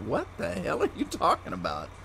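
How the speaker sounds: high-pitched mock disbelief; exaggerated incredulous question; retold bafflement. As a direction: replay your baffled reaction, voice jumping up. Retold baffled thought at invitation.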